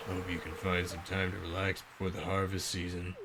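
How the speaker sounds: low, soft polite greeting; low gentle voice. Acted. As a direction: greet the crab low and calm. Same low character.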